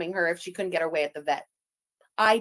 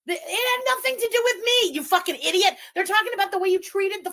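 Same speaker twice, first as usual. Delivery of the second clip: much higher and louder, furious; angry rant; 9 semitones higher, 4 dB louder than usual. Real, not acted. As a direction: snap at her with real anger. Arguing angrily with absent rival.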